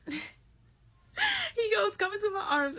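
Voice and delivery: high, slow, laughing through it. Real, not acted. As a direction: crack up while quoting. Retelling while laughing.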